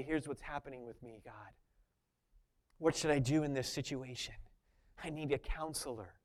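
a believer quietly confessing to God; quiet confession. Retold or imitated. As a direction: confide in God almost under your breath.

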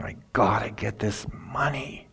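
softly, a hushed greedy craving; greedy feeling. Acted. Balaam giving in to greed, hushed craving. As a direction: murmur it like money-lust. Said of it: "get that greedy, greed feeling".

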